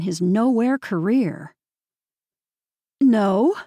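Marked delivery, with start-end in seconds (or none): 3.0-3.6 loud, curt condescending no. A narrator's reading. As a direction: shut her down with one word. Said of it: "how condescending he sounded".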